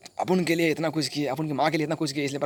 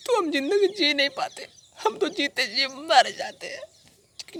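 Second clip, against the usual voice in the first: very high, weeping lament, dragging out the end; high-pitched sobbing voice, slowing as it goes; tearful wail; 12 semitones higher, slower than usual. Acted. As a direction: cry it out in despair. Imagines life without his mother.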